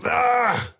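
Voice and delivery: sharp high yelp of shock; pained yelp. Retold or imitated. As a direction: yelp like you can't bear to watch. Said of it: "I'm like".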